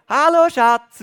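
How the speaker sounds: high, loud, sweet call to her husband. Retold or imitated. Wife greets husband coming home, calling out sweetly. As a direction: call out sweetly across the house. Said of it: "sie ruft so herzig".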